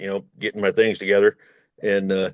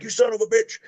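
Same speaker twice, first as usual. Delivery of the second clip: mock road-rage yell; 13 semitones higher than usual. Driver hollers at a car in traffic, mock yell. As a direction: yell it at the car that cut you off. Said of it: "you're hooting and hollering".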